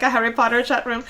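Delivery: usual speaking voice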